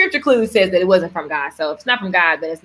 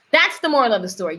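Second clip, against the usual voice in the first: usual speaking voice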